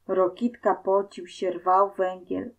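plain reading voice

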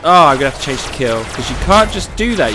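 ordinary talk, nothing marked

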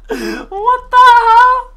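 high, loud, incredulous squeal; real high-pitched astonishment; hysterical amazement. Real, not acted. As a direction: squeal in delighted disbelief. Amazed at turning into a cow.

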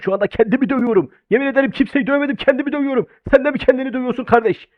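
usual speaking voice

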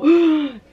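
sharp high gasp for air. Retold or imitated. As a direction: suck in a startled breath, imitating panic. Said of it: "it took us to go".

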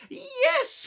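high, loud eager cry; witch crying yes. Acted. Witch welcomes the bird aboard, high loud cry. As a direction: shout a happy yes. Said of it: "cried the witch".

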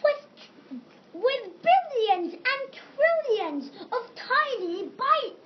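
dramatic build. Acted. Dramatic reading climax.

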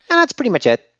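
sudden high, bright finish; high ending. Acted. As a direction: end on a high squeak. Ends speech to leader.

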